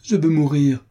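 high, loud cry of despair; delirious cry. Acted. Adrienne crying out to the doctor, delirious despair. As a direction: wail it like a woman losing her mind. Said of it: "s'écria Adrienne avec un accent de délire".

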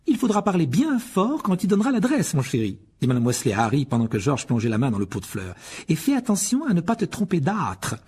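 a brisk mother of many sons; Mrs Weasley. Acted. Molly voiced. (voix de mère plus aiguë)

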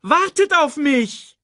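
high, slow drawn-out shout; calling out. Acted. Sasu left behind by the young lions, calling out. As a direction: call after them at the top of your range.

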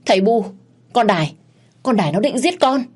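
high, loud panicked cry for help; husband calling to his parents for rescue, panicked. Acted. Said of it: "mà kêu lớn".

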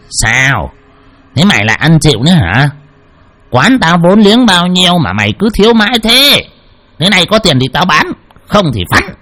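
giọng ông chủ quán cao hơn, cằn nhằn khó chịu; mắng khách nợ dai một cách bực bội. A narrator's reading. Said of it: "mà cằn nhằn". (chủ quán cằn nhằn gã khách ăn chịu)

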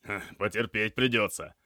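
short hum, then firm answer; grunted reply. A narrator's reading. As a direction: brush off the patient's request with a grunt. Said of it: "хмыкнул наставник".

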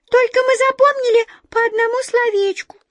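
very high, loud little mouse; tiny flustered mouse voice; sheepish squeak. A narrator's reading. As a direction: squeak out an embarrassed confession. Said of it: "смущённо пискнула одна из мышек".

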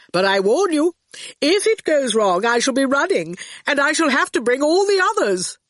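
high-pitched, lightly voiced character warning; Torquil giving warning. Torquil speaking in the story.

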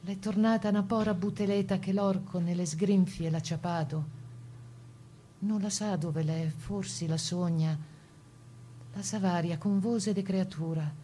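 measured, solemn dialect verse; poem recitation. Acted. Reciting a Venetian-dialect poem about a deportee, solemn.

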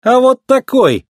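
high, drawn-out cheeky comeback; snappy retort. A narrator's reading. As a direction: answer back boldly. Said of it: "огрызнулся смелый дебютант".

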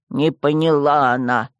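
low, slow mimic «Не поняла она»; sneering echo in a deep voice; mocking skull. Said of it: "Череп мрачно клацнул". (насмешливо, низким голосом)

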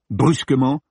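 usual speaking voice

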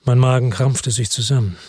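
usual speaking voice

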